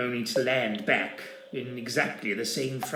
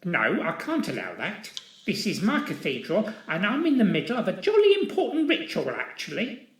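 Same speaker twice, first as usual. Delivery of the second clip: higher, faster indignant little man; indignant protest; 9 semitones higher than usual. Acted. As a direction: splutter it out indignantly. Polkinghorne protesting.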